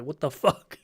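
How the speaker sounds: quiet bewildered aside; muted disbelief. Real, not acted. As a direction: let it drop out quietly, bemused. Same reaction, said muted.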